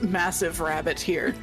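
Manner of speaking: ordinary talk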